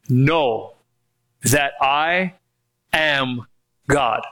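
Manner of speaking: KNOW, that I, am, GOD, each set apart by pauses; low, measured "Know that I am God" with a pause after "Know"; deliberate word-by-word emphasis. Shown as a demonstration. Quotes the verse for emphasis.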